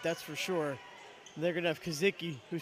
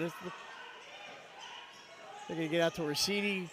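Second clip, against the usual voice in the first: normal talking voice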